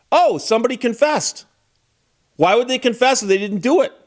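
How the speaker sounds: a credulous juror taking it at face value; naive juror. Retold or imitated.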